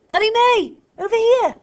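high, loud call across the landing. A narrator's reading. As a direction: call her name at full voice. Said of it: "Using her full voice this time, Fliss called out".

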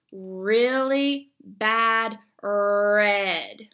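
very slow "Really bad red" with tongue pulled far back. Shown as a demonstration. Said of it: "pull that R sound as far back as you can".

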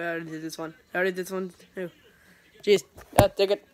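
ordinary talk, nothing marked

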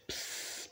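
put-on breathy voiceless psst from the baby; a tiny unvoiced hiss of a word; baby's psst. A narrator's reading. The baby says it to Mog: voiceless psst. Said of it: "It said".

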